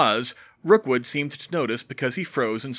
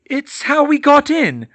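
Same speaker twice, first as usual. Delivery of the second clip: higher, loud, slow answer; strained answer; 11 dB louder, slower than usual. Acted. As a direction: force the words out. Hermione answering under pressure.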